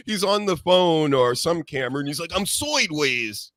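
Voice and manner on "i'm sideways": put-on slow Long Island accent, "sideways" as soydways; imitating a Long Island accent. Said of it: "that Long Island accent with the O's in front of the I's".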